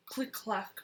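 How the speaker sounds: usual speaking voice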